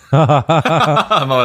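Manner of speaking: spricht unter Lachen; lachend. Real, not acted. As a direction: lacht die Worte heraus. Lachen mitten im Satz. (lachend gesprochen)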